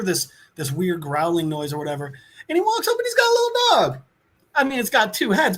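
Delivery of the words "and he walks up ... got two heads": fast, much higher gush; gushing excitement. Real, not acted. Revealing the hero's little dog, gushing excitement. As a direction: squeal it quickly over a cute dog.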